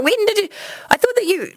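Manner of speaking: very high mock bafflement; incredulous. Wife imitating her husband catching on years later, high-pitched incredulity. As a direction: imitate a husband's indignant disbelief.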